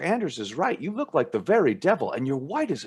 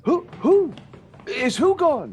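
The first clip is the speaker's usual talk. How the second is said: high, louder bewildered questions; startled confusion; 11 semitones higher, 5 dB louder, slower than usual. Acted. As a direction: caught off guard, confused. Woken abruptly, confused.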